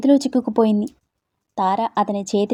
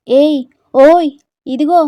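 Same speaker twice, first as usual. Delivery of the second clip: calling out; 5 semitones higher, 7 dB louder, slower than usual. A narrator's reading. Friends call from shore.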